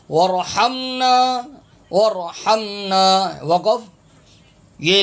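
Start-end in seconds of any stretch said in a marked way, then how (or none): 0.1-3.0 slow ورحمنا with throat h and held m; correct haa. Shown as a demonstration. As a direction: recite ورحمنا drawn out, sounding the haa. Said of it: "ہا میم زبر ہم". (ورحمنا ٹھہر کر، ہا کے ساتھ)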